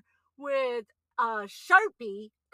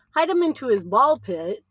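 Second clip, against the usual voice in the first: ordinary talk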